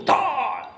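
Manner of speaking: high, drawn-out cartoon noise; voiced crash effect. King hurls rock at challenger, long cartoon crash sound. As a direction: stretch the sound of a flung rock.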